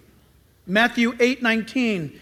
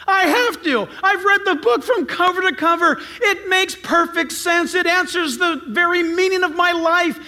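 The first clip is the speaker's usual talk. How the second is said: raised, high-pitched insistent testimony; impassioned conviction; 6 semitones higher than usual. Acted. Convinced reader testifies after reading the whole Bible, impassioned. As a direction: declare it with mounting urgency.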